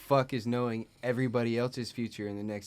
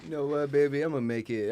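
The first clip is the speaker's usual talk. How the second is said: ordinary delivery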